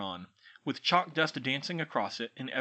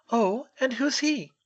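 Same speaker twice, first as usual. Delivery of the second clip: put-on lightly higher girl's voice; a hint of brisk schoolgirl; young witch; 11 semitones higher, 6 dB louder than usual. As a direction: Hermione inviting the elf, lightly voiced girl. Hermione asks.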